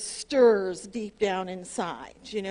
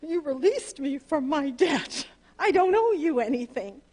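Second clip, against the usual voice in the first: tearful realisation; 3 semitones higher than usual. Retold or imitated. Her tearful answer to Jesus.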